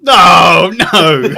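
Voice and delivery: loud, hammed-up cry of dismay; loud play-acted protest; mock dismay. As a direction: wail it loudly in mock despair. Player acts dismay at wrong number.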